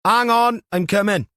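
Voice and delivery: hailing call. Acted. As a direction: shout through the door. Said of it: "A hailing voice followed".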